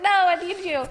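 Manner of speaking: playful desperate plea to the nurse; mock pleading. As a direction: whine like a clingy patient. Begging the nurse after she left.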